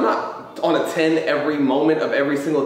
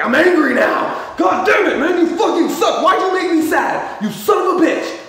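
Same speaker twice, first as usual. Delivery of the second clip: high, loud furious outburst; hammed-up screaming anger at the world; mock rage; 12 semitones higher, 4 dB louder than usual. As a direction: blow up in an acted, cursing tantrum. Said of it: "they get so mad at themselves".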